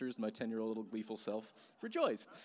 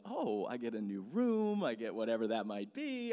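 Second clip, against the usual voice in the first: mock childlike excitement, swooping up; excited kid; 4 dB louder than usual. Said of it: "there's this excitement".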